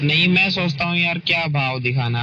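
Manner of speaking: ordinary talk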